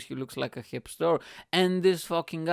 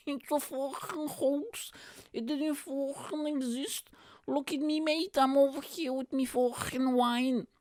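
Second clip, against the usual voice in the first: put-on high-pitched, stretched-out drunk whine; drunk guy impression; 12 semitones higher than usual. Drunk with wine ranting at comedian, high slurred mimicry. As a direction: imitate a drunk rambling conspiracy believer, way up high.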